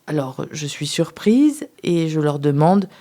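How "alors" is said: usual speaking voice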